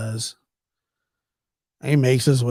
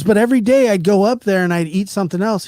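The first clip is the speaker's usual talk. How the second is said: fast and louder; rushed confession; 7 semitones higher, 6 dB louder, faster than usual. Retold or imitated. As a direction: blurt it all out at once. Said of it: "And he goes".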